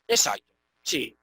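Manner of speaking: ordinary talk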